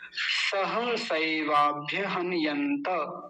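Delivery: usual speaking voice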